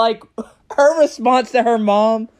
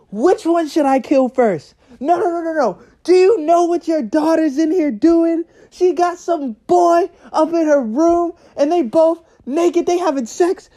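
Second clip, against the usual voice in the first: high, mock outraged mother on the phone; furious mom; 6 semitones higher than usual. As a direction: rant like an enraged mother.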